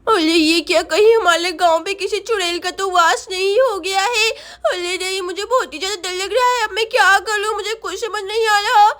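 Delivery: put-on lisping child fretting to herself; frightened little Sonam. Daughter hiding from a possible witch, frightened child voice.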